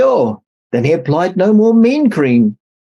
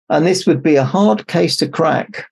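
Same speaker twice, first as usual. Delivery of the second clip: ordinary delivery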